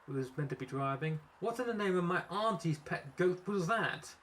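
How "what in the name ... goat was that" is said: high-pitched, indignant demand; outraged boss accusing the driver. A narrator's reading. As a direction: demand an explanation, pitch raised. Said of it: "Seymour accusingly inquired of Pocket Fluff".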